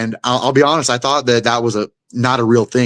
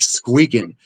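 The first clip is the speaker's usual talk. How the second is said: normal talking voice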